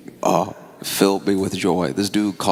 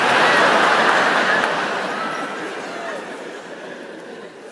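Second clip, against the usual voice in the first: big laugh. Real, not acted. Audience roars.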